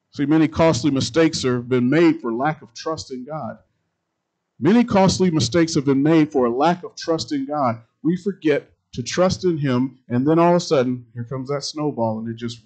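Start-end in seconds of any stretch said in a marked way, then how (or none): 0.1-11.0 loud, ringing sermon delivery; booming pulpit voice; full-force preaching. Acted. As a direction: preach it out to the congregation.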